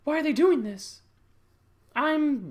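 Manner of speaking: high, hammed-up confused protest; distressed female lead. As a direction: cry it out in a put-on high voice. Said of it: "just telling herself".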